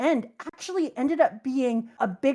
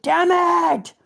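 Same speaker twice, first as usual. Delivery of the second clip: shouted curse; 6 semitones higher, 5 dB louder than usual. Retold or imitated. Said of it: "And Law's like".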